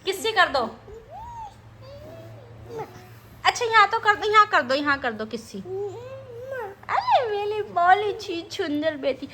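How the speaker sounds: high, slow cooing to a little daughter. Real, not acted. Mother cooing sweetly to her baby daughter, high baby talk.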